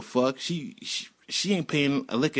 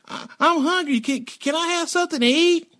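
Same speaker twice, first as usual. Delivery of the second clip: put-on much higher, small begging child; kid voice; 4 dB louder than usual. Boy Sonny begging neighbor Mike for food, high childlike. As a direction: squeak it out like a hungry kid. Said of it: "He like".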